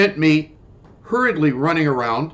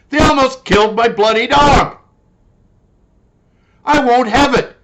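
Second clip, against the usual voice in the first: higher, indignant cry; angry outburst; 7 semitones higher than usual. Real, not acted. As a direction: burst out in outrage. Outburst about his dog.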